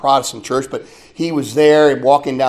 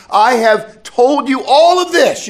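higher, slower, stressing all; Jesus' words proclaimed with weight; emphatic Gospel quote; 9 semitones higher, 6 dB louder, slower than usual. Retold or imitated. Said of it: "as he says in John fifteen".

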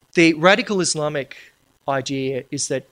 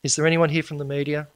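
ordinary talk

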